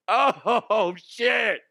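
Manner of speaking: laughing. Real, not acted. Laughs at the imagined fall.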